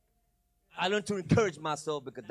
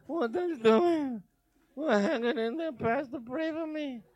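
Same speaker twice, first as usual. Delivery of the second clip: mock weary churchgoer; 7 semitones higher, slower than usual. Pastor mocking a self-pitying church member, sighing. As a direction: sound sorry for yourself.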